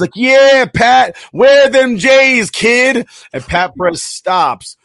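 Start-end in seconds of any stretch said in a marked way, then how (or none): 0.1-3.1 high, loud, drawn-out heckling holler; heckler's shout. Retold or imitated. Somebody calls out to Pat Perez, mock heckler's shout. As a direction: imitate a fan yelling across the course. Imitating a heckler yelling at golfer.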